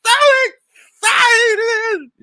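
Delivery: put-on high, far, crying shout of a hysterical girl; mock hysterical crying. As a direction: wail it like a breaking-down teen. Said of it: "And Jesse was like".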